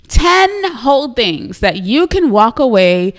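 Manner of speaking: ordinary talk, nothing marked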